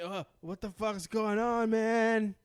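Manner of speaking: crazy high viewer. Pretend. Imagined stoned viewer watching the episode, slow bewilderment. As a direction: stretch it out like someone blown away while stoned. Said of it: "if you were just crazy high".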